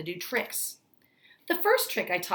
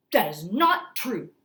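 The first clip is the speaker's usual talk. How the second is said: louder and slow denial; indignant cry; 5 dB louder, slower than usual. Acted. Manager insisting to grandmother, slow and indignant. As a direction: spell it out, offended. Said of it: "cried Mister Stringer".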